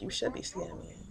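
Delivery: hushed, nearly whispered warning; quiet warning under the breath; understated retold warning. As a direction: drop to a very soft, breath-only threat. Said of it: "I'm like".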